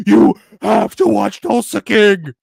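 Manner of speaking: hammy overbearing fan demand; mock pushy fan. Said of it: "I'm not gonna be like".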